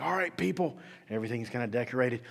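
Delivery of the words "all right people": bossy manager. Retold or imitated. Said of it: "the manager comes in, it's like".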